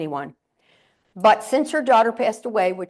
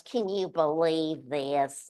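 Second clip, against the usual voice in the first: grumbling neighbor; 6 semitones lower, 4 dB quieter, much slower than usual. Retold or imitated. Grumpy neighbor complaining about snow.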